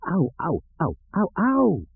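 high pained yelps, rising; hopping on a shot foot, yelping; yelling in pain. A narrator's reading.

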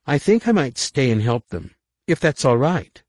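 put-on high, loud girlish plea; eager young girl. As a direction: ask eagerly like a teen.

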